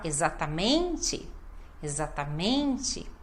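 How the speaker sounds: low, careful "exatamente" with MEN lengthened; stress on MEN. Shown as a demonstration. Teacher modelling correct speech, lengthened MEN in "exatamente". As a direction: stretch MEN in "exatamente", finish the final syllable. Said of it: "a gente tem que falar".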